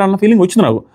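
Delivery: usual speaking voice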